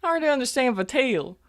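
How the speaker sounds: high-pitched, disbelieving repeat; incredulous echo. Acted. Half-human defending Vatil's speech to companion, incredulous.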